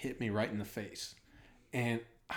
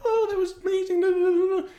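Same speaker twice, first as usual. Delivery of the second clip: very high, loud squealing gush; excited girlfriend; 7 dB louder, faster than usual. Retold or imitated. Guest mimics his excited girlfriend after the fight, squealing very high. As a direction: squeal like she's thrilled. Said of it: "She's like".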